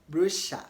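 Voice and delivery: brochure said BRU-sha, rhyming with Elisha; British brochure. Shown as a demonstration. Teacher showing students the British brochure, rhyming with Elisha. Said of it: "to rhyme with the name Elisha".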